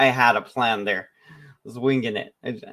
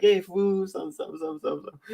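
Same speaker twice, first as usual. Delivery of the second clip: high mock Mexican-guy banter, trailing softer; imitated Mexican guy; 8 semitones higher, 4 dB quieter than usual. One Mexican guy to another, imitated slangy greeting. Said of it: "like, a Mexican guy with another Mexican guy".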